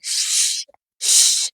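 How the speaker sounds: two voiceless shushing sounds; soft, breath-only shush; roommate's shush. Acted. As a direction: hush a noisy roommate with "shh, shh". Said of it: "तो वो क्या बोलेगी".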